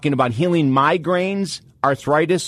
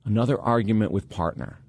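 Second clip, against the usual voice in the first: calm detached observer; 4 semitones lower, 6 dB quieter than usual. Retold or imitated. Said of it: "you think to yourself".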